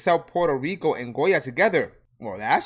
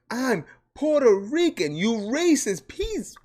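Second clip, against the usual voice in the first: indignant outburst; 8 semitones higher, 4 dB louder, slower than usual. Real, not acted. Angry retort to troll.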